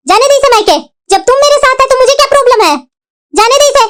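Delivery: high-pitched, indignant dismissal; angry dismissal. Acted. Mother-in-law telling her daughter to let her go, angry dismissal.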